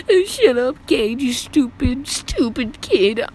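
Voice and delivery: squeaky girl imitation, high and drawn out; Anna. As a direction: squeal the insult slowly in a mock girl's voice. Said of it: "the freaking squeaky girl".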